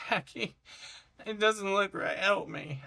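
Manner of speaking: high-pitched mock plea; helpless appeal, acted out; pleading for help. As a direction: whine for a friend's rescue, playfully. Acts out asking friend for help.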